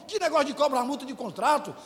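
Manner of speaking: ordinary delivery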